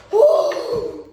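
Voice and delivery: sharp startled "oh"; surprised gasp. Real, not acted. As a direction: recoil from a sour smell. Reacting to the sniff test.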